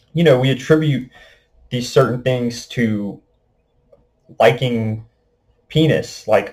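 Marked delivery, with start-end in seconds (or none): none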